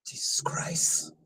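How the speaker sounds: breathy whisper under the breath; hushed exasperated whisper; whispered aside. Real, not acted. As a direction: mutter it to yourself in a whisper.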